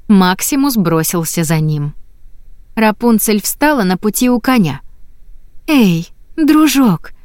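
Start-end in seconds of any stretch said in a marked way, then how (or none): 5.7-7.1 slow soothing call to a horse; gentle. A narrator's reading. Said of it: "мягко произнесла она". (мягко и медленно)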